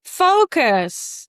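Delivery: focus said high and falling; student's focus. Shown as a demonstration. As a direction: commit to the word focus. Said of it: "I want you to actually say it".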